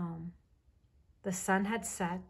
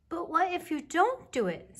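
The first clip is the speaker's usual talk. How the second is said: put-on high, louder questioning child voice; little bear cub; 11 semitones higher, 6 dB louder than usual. A narrator's reading. Baby Bear questions his mother about promises, little cub voice. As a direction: press the question like a small child.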